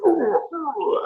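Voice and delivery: very high, light ooh-ooh; wobbling troll's ooh. Acted. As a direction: hoot high as if losing balance. Imitates troll wobbling and letting go.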